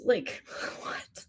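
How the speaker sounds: whispered, laughing what; breathless whisper. Real, not acted. Overwhelmed reader to her client, awed whisper. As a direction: barely voice it, stunned into a whisper. Speechless at reading result.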